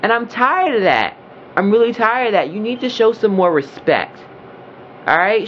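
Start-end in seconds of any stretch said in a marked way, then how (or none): 0.0-2.5 high, heated tirade; real outburst, voice up high; angry rant. Real, not acted. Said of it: "I don't mean to get loud". 5.1-5.5 high, heated tirade; real outburst, voice up high; angry rant. Real, not acted. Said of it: "I don't mean to get loud".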